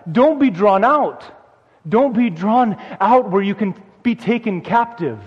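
urgent pulpit exhortation, building in force; rising preaching. Acted. Pastor urging the congregation to stay safe in Christ, insistent preaching. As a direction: plead with them to stay put, word by word.

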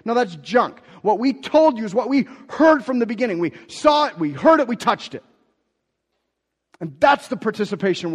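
loud, high, driving preacher voice; preaching at full force. Acted. Pastor preaching hard to the church, loud and high. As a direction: preach it out to the congregation.

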